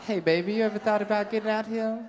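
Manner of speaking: quick, high sweet-talking pimp; smooth pimp pitch. Acted. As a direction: pitch her like a slick pimp. Pimp voiced hitting on woman.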